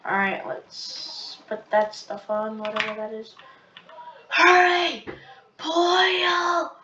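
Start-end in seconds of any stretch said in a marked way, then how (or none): 4.3-6.7 high, loud barked commands; urging shout. Real, not acted. Gamer yelling at the stove to boil, loud and high. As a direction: shout at the pot to hurry.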